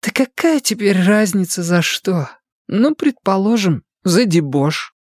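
lightly voiced defiant woman; sulky young woman. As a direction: shrug it off like a sulky girl. Sofia's figure voice.

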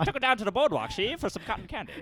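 tough guy movie mobster; old-timey gangster voice. Acted. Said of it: "the old-timey voice".

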